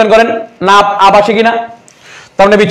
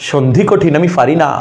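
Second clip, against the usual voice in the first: lower, softer, mock-whining student; whiny student complaint; 5 semitones lower, 3 dB quieter than usual.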